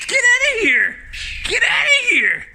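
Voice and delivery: loud high shout to drive it off; shouting it away. Real, not acted. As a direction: bark it out to scare the bird away. Shooing an aggressive bird.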